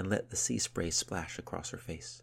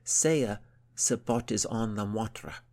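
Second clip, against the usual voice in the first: higher, emphatic teacher's prompting; insistent; 4 semitones higher, 4 dB louder, slower than usual. A narrator's reading. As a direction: insist the pupil repeats it. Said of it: "insisted Yadith".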